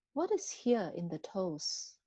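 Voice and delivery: ordinary talk, nothing marked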